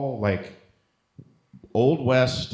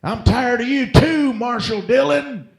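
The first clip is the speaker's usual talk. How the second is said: much higher, louder, slow stagey drawl-free western line; mock outlaw actor; 8 dB louder, slower than usual. Comedian imitates the outlaw answering the marshal, high and loud. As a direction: overplay the outlaw's comeback. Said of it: "And he's like".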